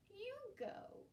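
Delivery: put-on high, slow whining child voice; whiny little boy. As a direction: complain like a small brother. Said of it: "He pushed Hillel".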